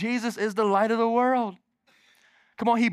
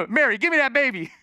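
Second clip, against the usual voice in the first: eager Simeon; 6 semitones higher, 4 dB louder than usual. Retold or imitated. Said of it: "Simeon goes".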